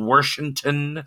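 Washington said slowly as "Warshington". Real, not acted. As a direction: put an R into the first syllable of Washington. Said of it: "There's no R in Washington".

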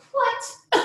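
high-pitched retold disbelief; acted shock. Mother told baby's head is out, high acted shock. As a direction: squeak it in astonishment.

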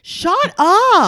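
loud, high, drawn-out outburst; exasperated yell. Real, not acted. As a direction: cut in with fed-up disbelief. Fed up with retold crying.

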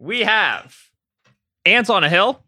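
announcing the clues, high and slow; game show host. Acted. Announcing jargon terms.

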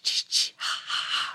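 breathy whispered ch ch ha ha; imitating the horror movie killer cue; slasher whisper sound. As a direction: hiss the killer's stalking sound. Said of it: "ha sound".

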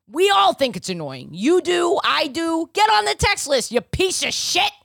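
high, loud comic tirade building to the end; mock exasperated yelling. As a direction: yell the plug like a fed-up hype host. Exasperated plug turns into mock tirade.

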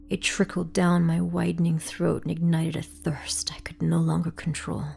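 ordinary delivery, nothing marked

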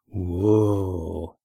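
low, drawn-out whoa of admiration; impressed, sinking into a low register; admiring exclamation. A narrator's reading. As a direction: marvel in a long low exclamation. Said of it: "exclaimed Red Ian admiringly".

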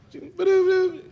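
high, quick electronic boop; imitated card-reader beep. Girl swipes the gift card, imitated beep. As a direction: mimic a machine's high two-note beep.

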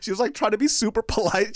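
ordinary delivery

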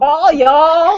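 lightly tipsy, drawn-out "All y'all", rising at the end; a bit drunk. Shown as a demonstration. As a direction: try "All y'all" as if a little drunk, laughing. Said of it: "without sounding just a little bit drunk".

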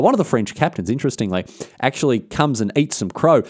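plain reading voice

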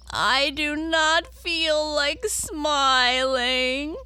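high, very slow, loud whine; tearful Tearful. Acted. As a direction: draw it out into a wail. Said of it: "her eyes filled with tears again".

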